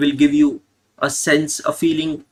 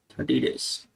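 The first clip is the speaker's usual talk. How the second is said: murmured aside; 10 dB quieter than usual. Real, not acted. Aside correcting month length.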